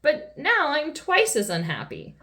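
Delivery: high, lightly wailed lament; wailing. A narrator's reading. King lamenting to Miss Sunshine, mildly wailing. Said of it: "he wailed".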